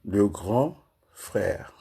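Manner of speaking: very slow, each syllable set apart. Shown as a demonstration. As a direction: spell it out slowly, one syllable at a time. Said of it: "Je vais aller par syllabe".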